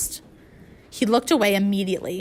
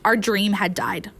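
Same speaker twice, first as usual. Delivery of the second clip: ordinary delivery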